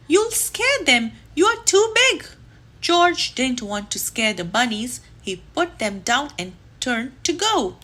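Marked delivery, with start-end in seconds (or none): 0.1-2.3 higher, louder scolding shout; stern zookeeper. Acted. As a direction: scold him like an alarmed zookeeper. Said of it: "she said".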